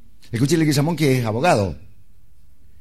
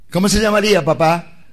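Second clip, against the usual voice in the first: put-on insistent child; 5 semitones higher than usual. Daughter insists again.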